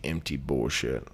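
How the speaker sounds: ordinary talk, nothing marked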